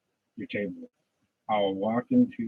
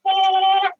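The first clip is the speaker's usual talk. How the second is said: sharp high cry; 12 dB louder than usual. Acted. Outburst mid sermon.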